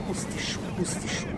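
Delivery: breathless whisper of relief. Real, not acted. Streamer whispers in relief after a close call in the game. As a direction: whisper it after a narrow escape.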